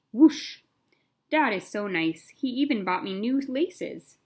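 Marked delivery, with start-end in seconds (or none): 0.1-0.6 loud whoosh effect. Acted. Sound effect of laces.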